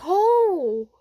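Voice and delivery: high, loud, drawn-out oh; acted surprise. Deku seeing everyone at his surprise party, astonished.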